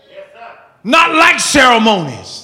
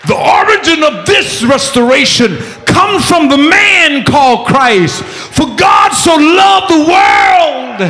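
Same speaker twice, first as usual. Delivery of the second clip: preaching at full force; 6 semitones higher than usual. Real, not acted. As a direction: build and hammer it to the congregation.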